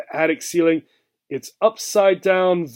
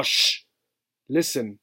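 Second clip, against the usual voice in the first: softer, hushed warning; quiet them down, ears pricked; 4 semitones lower, 5 dB quieter than usual. Acted. Mouse silences Obie to listen.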